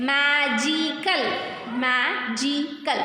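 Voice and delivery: "magical" slowly split as ma, gi, cal; three-syllable demonstration. Shown as a demonstration. Teacher showing pupils three syllables, slow and segmented. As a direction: draw out each syllable of "magical". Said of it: "These are three syllables".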